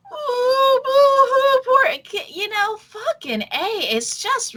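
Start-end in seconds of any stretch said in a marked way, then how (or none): 0.0-2.3 high, hammed-up mock sobbing; sarcastic boo-hoo wail, very high; mock boo-hoo crying. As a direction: ham up a fake cry. Mocking sobbing over sob story.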